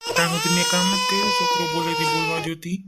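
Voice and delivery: fast, tearful, voice breaking into sobs; an old man crying as he pleads; crying father. Acted. Father in the family quarrel breaks down crying.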